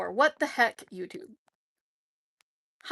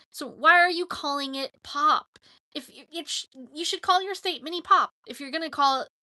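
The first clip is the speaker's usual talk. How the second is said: ordinary delivery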